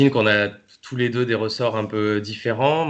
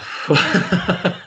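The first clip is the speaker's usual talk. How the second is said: amused laugh; 9 semitones higher, 3 dB louder than usual. Real, not acted. Surprised by a cheeky question.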